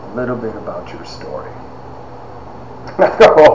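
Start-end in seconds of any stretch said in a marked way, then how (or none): 0.0-1.5 eccentric professor, drawn out with long pauses; Doctor Stoneburner. Retold or imitated. Teacher asking student to tell his story, eccentric impression. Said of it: "he was all eccentric".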